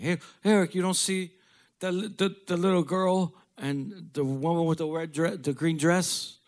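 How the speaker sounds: high, wandering voice of a feverish dad; imitating delirious dad. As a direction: play the sick father seeing ghosts.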